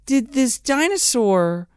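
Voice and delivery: slow, high, incredulous delivery; mock disbelief. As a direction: stretch it out in baffled disbelief. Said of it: "And I'm like".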